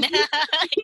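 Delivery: bright high laugh; quick delighted laugh; burst of laughter. Real, not acted. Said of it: "Ketawa dia langsung".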